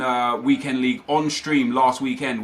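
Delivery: usual speaking voice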